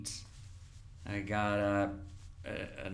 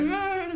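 high whinnying laugh like a horse; 5 dB louder than usual. Shown as a demonstration. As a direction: let out a shrill horse whinny. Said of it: "laugh like a horse".

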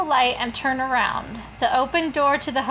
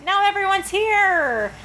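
cheery announcement; 8 semitones higher, 3 dB louder than usual. Shown as a demonstration. Hostess announcement.

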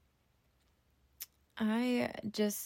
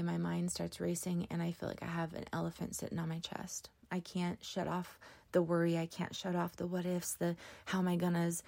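ordinary talk, nothing marked

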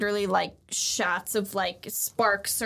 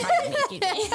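high, squeaky little excuse-me through a laugh; squeaky polite voice; faster than usual. Retold or imitated. Luffy pushing through the crowd, squeaky and giggly.

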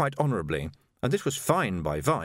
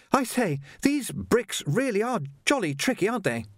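put-on much higher, polite young Jocasta, a touch breathless; young woman voiced; 10 semitones higher than usual. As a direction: play a well-mannered girl treading water. Jocasta slipping on bricks.

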